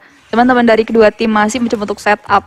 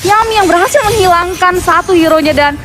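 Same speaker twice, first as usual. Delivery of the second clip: esports caster; 7 semitones higher than usual. Real, not acted. Caster reacting to a kill.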